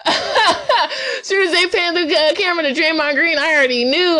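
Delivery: high-pitched laughter through the words; laughing too hard to get the words out. Real, not acted. Vlogger laughing at Draymond on camera. Laughing while telling the story.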